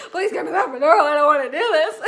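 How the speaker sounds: loud mock-desperate pleading with laughter; freaking out. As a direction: beg loudly, half laughing at the retelling. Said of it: "So I was freaking out".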